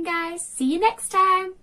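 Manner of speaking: plain reading voice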